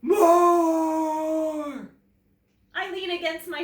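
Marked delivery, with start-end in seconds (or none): 0.0-1.9 huge, loud roaring monster voice; dinosaur roar. A narrator's reading. Snow dinosaur demanding more food, roaring. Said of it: "but she still booms".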